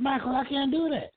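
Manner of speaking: high-pitched plea that he can't do it; retold protest. Caller reenacting his refusal to the host's father, high protest.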